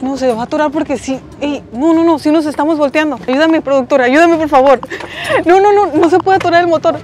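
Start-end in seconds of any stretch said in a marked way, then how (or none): 2.3-7.0 frantic, rising panic; panicked yelling. Real, not acted. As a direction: shout it in a real panic. Panics as boat motor stalls.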